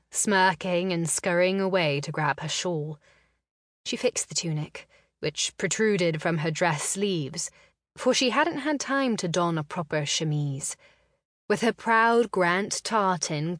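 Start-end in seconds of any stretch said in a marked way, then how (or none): none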